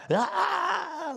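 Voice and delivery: high possessed shriek; exorcism scream. Retold or imitated. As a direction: let out a wild possessed scream. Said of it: "like an exorcism".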